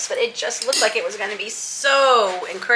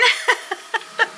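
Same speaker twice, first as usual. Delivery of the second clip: high unvoiced giggle; 12 semitones higher, 4 dB quieter than usual. Real, not acted. Delighted at falling snow.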